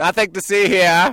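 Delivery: high, loud put-on officer voice; mock cop. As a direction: play a cop waving onlookers away. Jokingly plays the drunk cop.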